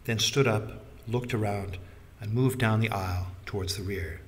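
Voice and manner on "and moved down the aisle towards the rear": ordinary delivery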